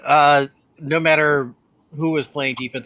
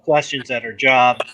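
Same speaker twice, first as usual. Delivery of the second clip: usual speaking voice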